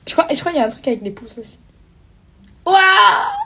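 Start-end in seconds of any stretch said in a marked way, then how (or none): 2.7-3.3 loud, high wow of delight; excited shout. Real, not acted. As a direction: shriek with surprise. Excited at heart effect.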